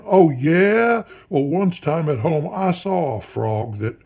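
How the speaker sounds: loud, high boast, easing as it runs on; honking, rising. A narrator's reading. Counsel bragging about a frog back home, loud honk. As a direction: honk out a challenge and launch into bragging. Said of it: "his pitch and volume rising once again".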